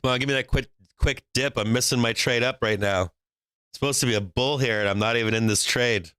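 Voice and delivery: ordinary talk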